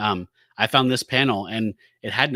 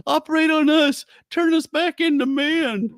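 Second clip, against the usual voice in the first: high, slow, louder mopey plea; mock sad plea; 6 dB louder, slower than usual. Griffith begging the doctor for surgery, hammed-up sad whine. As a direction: beg pitifully, hammed-up. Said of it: "Griffith looks all sad".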